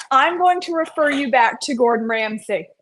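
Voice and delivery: ordinary talk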